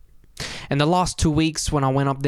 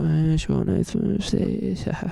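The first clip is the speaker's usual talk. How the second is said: whispered greeting; 5 semitones higher, much slower than usual. Retold or imitated. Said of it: "But then I was like".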